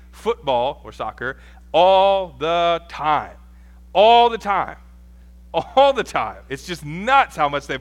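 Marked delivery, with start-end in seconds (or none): 1.7-6.4 "All the time" stretched out and punched loud; drawn-out, loud stress on "All"; emphatic repetition. Real, not acted. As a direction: hammer "All the time", louder on each repeat after the first. Hammering how constantly they play.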